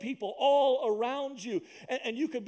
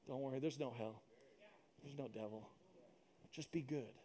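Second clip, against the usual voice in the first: much softer, lower, coaxing lie; sly devil voice; 8 semitones lower, 17 dB quieter than usual. Acted. The devil lulling people that hell isn't real, soft and sly. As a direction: lull them like a sneaky devil.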